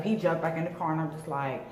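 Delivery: usual speaking voice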